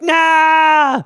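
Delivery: sharp yelled nah; panicked shout. Retold or imitated. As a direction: recoil in horror. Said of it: "you're like".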